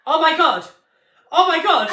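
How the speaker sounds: high, loud laughing gasp; imitating her friend's high, loud squeal of shock; shocked cry. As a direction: squeal it in disbelief. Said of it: "you went".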